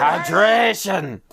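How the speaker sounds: slow, stretched, loud call; drawn-out shout. Real, not acted. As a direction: yell it out long and slow. Echoing the hydration call.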